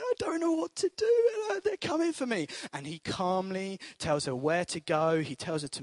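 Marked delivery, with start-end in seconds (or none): 0.0-2.5 high, frantic plea down the phone; much higher, scared cry for help; panicked daughter. Retold or imitated. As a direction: squeal it like a terrified girl hiding. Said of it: "she's going".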